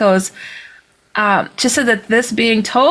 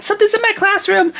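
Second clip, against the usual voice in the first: high-pitched panicked quote; retold panic; 12 semitones higher, faster than usual. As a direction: imitate a panicked caller, pitched way up. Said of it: "she's talking really fast".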